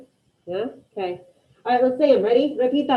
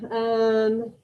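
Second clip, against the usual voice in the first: normal talking voice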